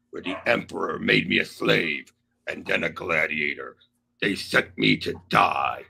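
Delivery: very low, slow brute voice; slow, deep monster menace; Hulk voice. Pretend. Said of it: "may I read Hulk's message".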